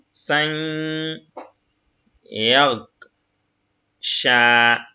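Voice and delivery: each Arabic syllable said apart, slowly; syllable reading. Shown as a demonstration. Teacher reading Arabic syllables to the class, slow and separated. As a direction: spell the word out syllable by syllable.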